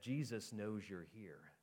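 low, hushed, slow warning; slow, quiet voice from the shadows; voice in the dark. A narrator's reading. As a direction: intone it softly like an unseen watcher. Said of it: "a voice in the dark said".

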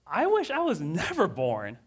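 loud, high, despairing cry to God; anguished outcry. Acted. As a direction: wail it up to heaven in pain. Jeremiah crying out to God.

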